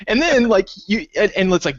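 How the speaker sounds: usual speaking voice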